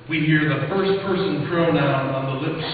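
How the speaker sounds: ordinary delivery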